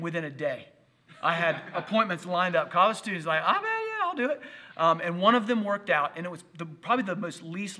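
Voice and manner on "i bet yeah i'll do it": high, eager mock student voice. Pastor mimicking eager students, high and bright. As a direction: chirp it up like keen college kids.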